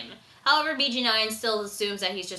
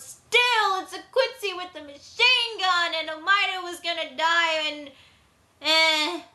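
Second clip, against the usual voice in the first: exasperated; 6 semitones higher than usual. Real, not acted. Exasperated over the machine gun.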